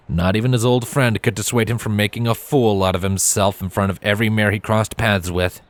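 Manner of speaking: usual speaking voice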